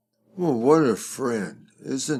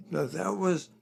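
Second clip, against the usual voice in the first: usual speaking voice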